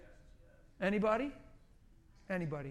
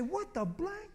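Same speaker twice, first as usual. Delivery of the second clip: high-pitched imitated shock; mock astonishment; 10 semitones higher, 3 dB quieter, slower than usual. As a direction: mimic someone stunned, voice pitched way up. Imitating astonished people who swear.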